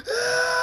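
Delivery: loud, very high shriek; scream of pain. A narrator's reading. Zapper rammed through the screen, screaming. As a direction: shriek at full voice. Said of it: "Zapper screams".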